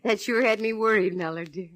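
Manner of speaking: normal talking voice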